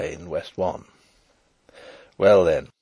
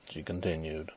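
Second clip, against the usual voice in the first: ordinary delivery, nothing marked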